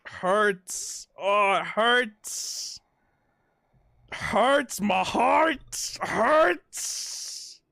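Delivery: high, slow whimpering; drawn-out pained whimper; aching whine. Real, not acted. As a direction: moan it like your heart aches. Emotional reaction to sad scene.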